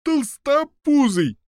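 high, drawn-out taunt; yelled jeer. A narrator's reading. Prisoner distracting the giant, high drawn-out jeer. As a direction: hurl the insult high and loud. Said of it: "из всей мочи крикнула следующая камера".

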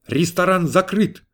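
a restrained narrated shout; shouted announcement. A narrator's reading. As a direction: call it out high and drawn out. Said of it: "И я закричал".

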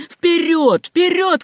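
high, ringing command; insistent high cry; urging shout. A narrator's reading. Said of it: "кричал Симпсон".

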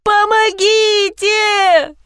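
very high, slow wailing plea; desperate cry for help. A narrator's reading. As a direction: stretch it out, pleading, high. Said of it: "закричала я".

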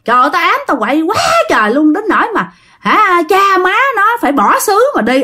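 high-pitched, lightly voiced possessed man; possessed man's retort. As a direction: brag as the spirit, quick then easing. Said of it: "nói vậy đó".

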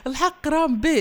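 high, loud, through laughter; amused, laughing too hard to talk evenly. Real, not acted. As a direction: crack up while talking. Host cracks up at child's answer.

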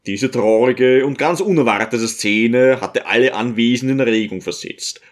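plain reading voice

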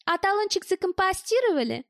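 high, piping needling question; squeaky taunt. A narrator's reading. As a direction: pipe it sweetly to taunt him. Woman keeps squeaking her taunt.

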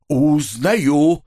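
drawn-out cry of sudden recognition; exclamation of recognition. A narrator's reading. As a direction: draw the word out as realization dawns. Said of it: "воскликнул прозревший Вася". (протяжное восклицание узнавания)

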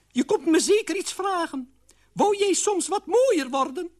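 very high-pitched character voice; old aunt figure. Acted. Aunt Oena welcomes the queen, high character voice. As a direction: pipe it up in a high put-on voice.

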